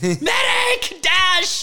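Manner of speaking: shrill panicked yelling; man down shout. Retold or imitated. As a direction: scream for a medic, way up high. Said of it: "looked around like".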